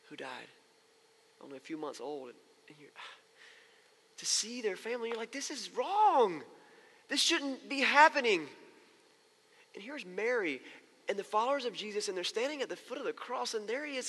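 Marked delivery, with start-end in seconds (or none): 5.3-8.5 higher, drawn-out cry of protest; anguished protest. Retold or imitated. Pastor voicing mourners at a baby's funeral, anguished protest. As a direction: stretch it out in grieving disbelief.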